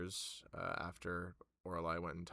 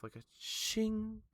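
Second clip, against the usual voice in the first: chime sound; 14 semitones higher, slower than usual. Acted. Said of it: "chime noise that goes off".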